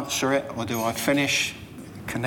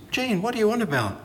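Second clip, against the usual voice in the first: higher, fast, incredulous retort; baffled; 9 semitones higher, faster than usual. Retold or imitated. Jeff on the ladder answering Jean, fast and baffled. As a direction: toss it back fast, baffled.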